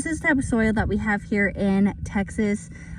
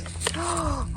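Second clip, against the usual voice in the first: delighted gasp; 6 semitones higher, 4 dB quieter than usual. Real, not acted. Tool pulls weed out.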